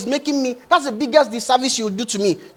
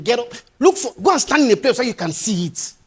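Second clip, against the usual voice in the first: ordinary talk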